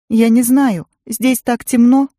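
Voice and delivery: put-on high, slow, tearful child's voice; a lost child about to cry; tearful little girl. A narrator's reading. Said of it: "Она снова заплакала".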